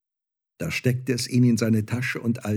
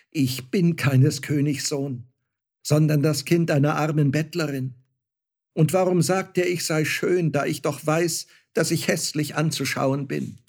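put-on higher-pitched, distressed young character; protesting Star-Child; 6 semitones higher, 3 dB louder than usual. A narrator's reading. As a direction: protest earnestly with raised pitch. (gequält widersprechendes Sternenkind, höhere Stimme)

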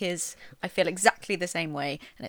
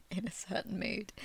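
whispering; 8 dB quieter than usual. Real, not acted. Trailing aside after laughing.

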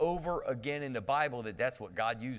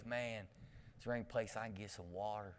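low, soft, fast laid-back drawl; Matthew McConaughey drawl; 5 semitones lower, 14 dB quieter than usual. Retold or imitated. Pastor imitating a sweaty kid asking for water. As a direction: mumble it cool and unbothered. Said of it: "but he talked like this".

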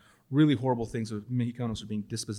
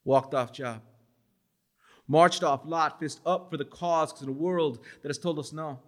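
a poet reading his life story to the audience; spoken-word poem; 4 semitones higher than usual. Acted. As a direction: perform it like a slam poem, every line landing.

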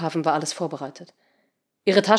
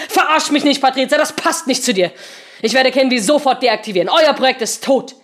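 angry; 6 semitones higher, 7 dB louder than usual. Acted. Seemann furious at Patrizia.

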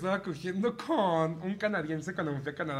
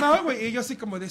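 normal talking voice

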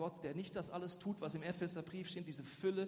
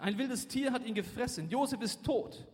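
loud, higher dramatic reading; a father's anguished cry, read aloud; 6 semitones higher, 8 dB louder than usual. A narrator's reading. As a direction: proclaim the loss with raised voice. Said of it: "rief er".

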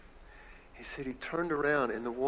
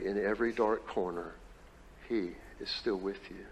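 preaching build; 5 dB louder than usual. Acted. Pastor preaching to the Easter congregation, swelling then hushed. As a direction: rise to a peak then fall to a hush.

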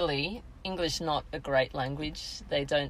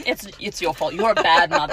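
louder, a hammed-up scold from a stranger; mock judgmental stranger; 8 dB louder than usual. Friend voices plane passengers judging mum, mock scolding. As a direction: play the tutting passenger blaming mum.

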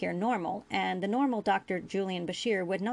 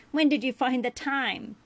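higher, louder demand; indignant Ferengi boy; 6 semitones higher, 5 dB louder than usual. A narrator's reading. Ferengi boy quizzes the doctor, indignant. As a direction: demand it, mildly indignant and pitched up.

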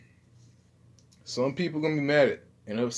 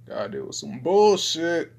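high, loud street exclamation; 6 semitones higher, 4 dB louder than usual. Shown as a demonstration. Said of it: "Haven't heard this voice in a fucking long time".

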